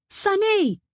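high, loud shout falling at the end; sharp, high-pitched call; yelled name. Acted. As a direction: yell out for someone in another room. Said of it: "I yelled".

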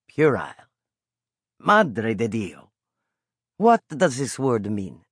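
lightly voiced Italian peddler calling out; old Italian vendor. A narrator's reading. Old vendor calling the boy to his pastry cart. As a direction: coax the boy over like a kindly street vendor.

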